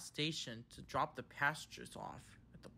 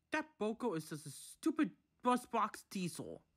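higher-pitched scowling complaint; 7 semitones higher than usual. A narrator's reading. Thomas at the indignation meeting in the shed, scowling. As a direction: grumble indignantly, pitch raised. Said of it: "Thomas scowled".

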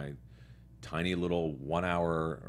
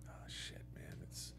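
hushed, breathy aside to himself; whispered thought; 17 dB quieter, slower than usual. Retold or imitated. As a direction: whisper the worry under your breath. Retold inner panic before audition.

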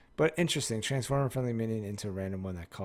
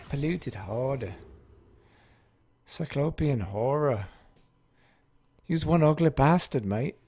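playful, hammed-up British or Scottish accent; switching British accents; 5 semitones higher, 6 dB louder than usual. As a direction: try on wandering British accents, growing louder. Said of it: "Just switching accents. Am I Scottish?".

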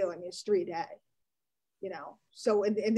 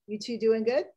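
ordinary delivery

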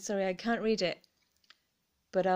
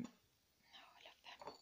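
adoring whisper; 25 dB quieter, slower than usual. Real, not acted. Whispering love for the polka cups. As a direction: whisper it lovingly.

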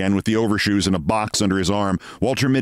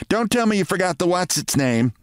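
nagging wife; 9 semitones higher than usual. Retold or imitated. Wife scolding Mitty for forgetting the errand. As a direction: needle him about the forgotten item. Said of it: "she would ask".

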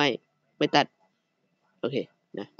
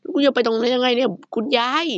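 baffled exclaim; 8 semitones higher than usual. Real, not acted. Baffled at glitching Granny.

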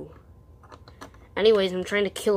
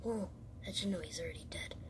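normal talking voice

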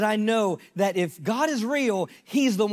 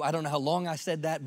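ordinary delivery

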